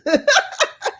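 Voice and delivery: high-pitched burst of laughter. Real, not acted. Host amused by a chat room answer, high laugh.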